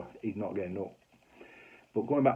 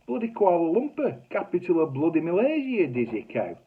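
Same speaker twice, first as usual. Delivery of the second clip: mock exasperated; 9 semitones higher, 7 dB louder than usual. Husband retorts to wife.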